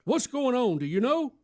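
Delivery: high pleading voice; wife voice. Retold or imitated. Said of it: "But she goes".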